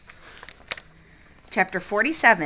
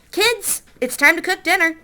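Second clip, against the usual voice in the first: hollered; 8 semitones higher than usual. A narrator's reading. Father calling his kids to dinner, hollering up the stairs.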